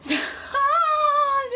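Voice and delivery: sharp high-pitched sob; one crying burst pushed out high; real cry, high and loud. Real, not acted. Breaking down, crying.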